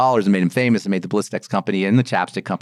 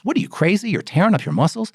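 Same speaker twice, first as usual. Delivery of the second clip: pitched well up, incredulous; mock disbelief; 8 semitones higher than usual. Host mocking a gym-goer with high incredulous disbelief. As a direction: scoff like it's insane to lift.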